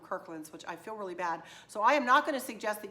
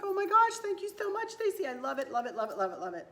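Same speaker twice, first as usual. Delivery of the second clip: high, excited squeal of thanks, tumbling down in pitch; happy gushing; 4 semitones higher, 5 dB louder, faster than usual. Retold or imitated. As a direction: re-enact gushing delight over a gift. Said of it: "and I was all happy".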